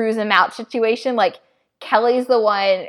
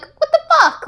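shocked; 5 dB louder than usual. Real, not acted. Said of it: "I'm like".

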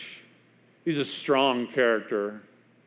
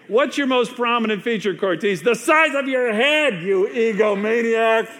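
God's booming rebuke; 8 semitones higher, 4 dB louder than usual. Acted. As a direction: belt it out as an exasperated God telling off a big-head. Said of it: "what God was saying to me".

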